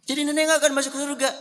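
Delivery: put-on high, fast, fretful old woman's voice; squeaky worried grandma; anxious grandmother. Old woman pleads with the Prophet.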